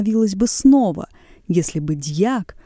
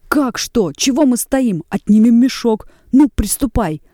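loud urging; 5 semitones higher, 6 dB louder than usual. A narrator's reading. Urging to seize the sack.

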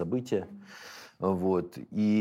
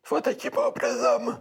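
words spoken on an in-breath, pitched very high; talking while inhaling; 3 dB louder, slower than usual. Shown as a demonstration. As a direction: pull air in while you speak, strained and slow. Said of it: "Они начинают говорить на вдохе".

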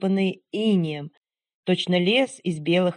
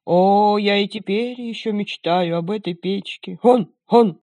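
put-on loud reminiscing old watchdog; old dog voice; 4 dB louder than usual. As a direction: boast like a pampered old dog. Dog tells his story.

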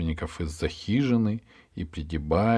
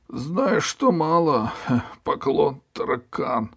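sad; 8 semitones higher, 3 dB louder, slower than usual. Acted. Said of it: "печально ответил перевозчик".

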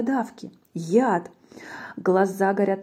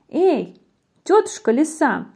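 high hail to someone distant; mouse calling out; 6 semitones higher, 4 dB louder than usual. A narrator's reading. Mouse hails auntie fox, high calling voice. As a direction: call out in a raised, high voice. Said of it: "мышонок закричал".

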